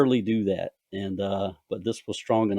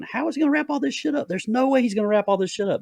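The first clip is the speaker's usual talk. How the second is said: high, quick, disbelieving outburst; racing, high-pitched incredulity; incredulous reader; 14 semitones higher, faster than usual. Retold or imitated. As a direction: blurt it in mock disbelief, pitched way up. Acts out disbelief while reading.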